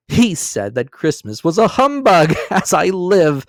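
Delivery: loud, high, laughing through the words; gleeful laughing exclamation; crying out laughing. A narrator's reading. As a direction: cry it out amid laughter. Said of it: "cried Scrooge's nephew".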